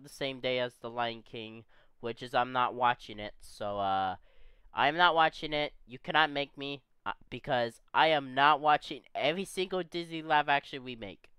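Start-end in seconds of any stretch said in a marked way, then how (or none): none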